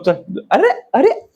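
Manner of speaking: ordinary talk, nothing marked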